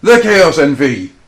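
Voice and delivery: slow booming circus announcer; ringmaster. Pretend. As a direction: roll it out like a big top. Continuing the grand intro.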